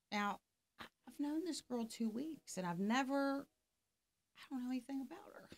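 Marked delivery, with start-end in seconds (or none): 4.4-5.5 fast whispered aside, half to himself; quick whisper. Real, not acted. Telling a friend he barely knew her, in a quick whisper. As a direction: drop to a rushed whisper, as if confiding. Aside about a stranger crying.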